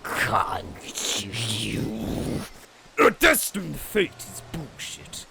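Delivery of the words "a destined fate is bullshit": defiant shout. Acted. Defiant hero rejects fate.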